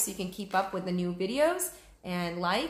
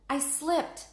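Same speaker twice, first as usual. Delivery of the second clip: voiced Annie; 8 semitones higher than usual. Annie slipped into mud.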